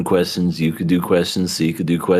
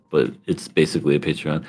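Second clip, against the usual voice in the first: ordinary delivery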